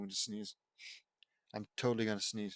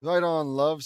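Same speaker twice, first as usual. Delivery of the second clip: put-on a louder, bright-eyed British accent; 3 semitones higher, 9 dB louder, slower than usual. Luna greets Vince in a British accent. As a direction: drop into a British rocker voice for "Right on, love". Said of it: "says Luna in a British accent".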